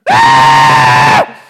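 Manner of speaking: long, loud mock scream of fright; terrified scream. Disciples in the boat screaming like little girls, terrified scream. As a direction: scream like a scared little girl. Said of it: "They screamed!".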